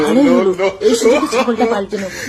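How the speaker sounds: low, sly plotting voice; scheming aside. Acted. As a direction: drop the pitch and plot in secret. Aunt gloats over her plot.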